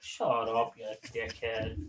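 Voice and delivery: low-key, soft retort; quiet, dismissive retold insult; muttered comeback. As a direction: toss it back under your breath. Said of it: "I'm like".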